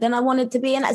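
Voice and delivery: ordinary talk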